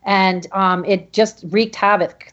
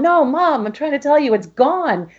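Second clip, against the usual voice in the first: tearful insistence; 6 semitones higher than usual. Retold or imitated. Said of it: "kept trying to say to my mom".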